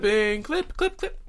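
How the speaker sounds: rising squeaky chant; high squeaky. Pretend. Mocking the word clipping.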